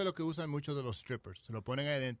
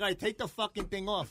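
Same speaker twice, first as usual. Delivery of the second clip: higher-pitched mock exasperation; retold exasperated surrender; 6 semitones higher than usual. Guest retells giving in to his friend, exasperated. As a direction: give in, fed up, for comic effect.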